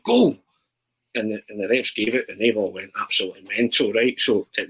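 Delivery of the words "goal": short high-pitched goal shout. Retold or imitated. Schoolboy claiming a shot that went wide, loud shout. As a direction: yell it like a kid claiming a goal. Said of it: "I've shouted".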